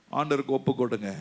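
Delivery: ordinary delivery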